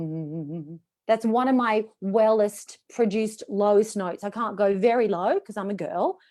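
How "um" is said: low hummed note. Shown as a demonstration. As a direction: drop to your lowest note. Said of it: "I'll produce it for you".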